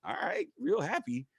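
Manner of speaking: high, slow reading of a happy note; a touch of high mock cheer. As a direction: read it back in a high sing-song. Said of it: "I'm reading it like".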